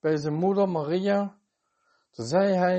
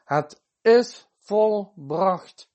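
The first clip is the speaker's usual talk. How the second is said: very slow, louder, weighty declaration; retold loud cry; 4 semitones higher, 5 dB louder, much slower than usual. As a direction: proclaim it word by word, higher and firmly raised. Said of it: "toen riep hij dus met luide stem".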